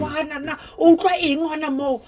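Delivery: high, mocking parent voice; imitating a disapproving parent. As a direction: play the scandalised parent. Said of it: "But they're like".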